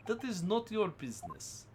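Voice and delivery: lightly put-on Russian accent, broken English; a hint of a gruff Russian sailor guarding the cabins. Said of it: "same Russian voice".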